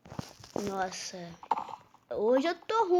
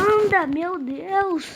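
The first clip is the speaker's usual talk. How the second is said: impatient; 4 semitones higher, 7 dB louder, slower than usual. Real, not acted. Impatient waiting for match start.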